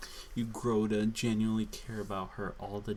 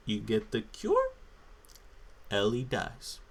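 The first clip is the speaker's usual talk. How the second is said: ordinary talk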